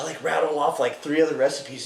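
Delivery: ordinary talk, nothing marked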